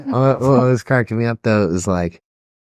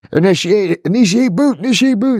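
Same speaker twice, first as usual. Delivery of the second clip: rising higher and louder, initiate boot barked like a launch order; hammed-up command; 13 semitones higher, 3 dB louder than usual. Retelling the dad's anger at his daughter, a hammed-up rising command. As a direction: crank it up like a mad control room.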